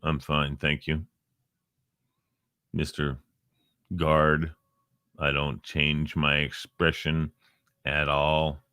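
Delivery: low, soft, expressionless reply; mock stone-faced palace guard; deadpan guard. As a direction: answer without moving a muscle. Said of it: "They go".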